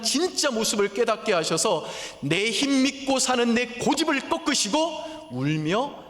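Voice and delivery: 높고 크게 외치듯 설교; 목소리를 높여 절박하게 호소하기; impassioned preaching. Acted. Preacher builds to full force. (열정적으로 외치는 설교)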